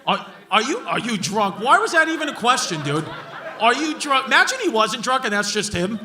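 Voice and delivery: ordinary talk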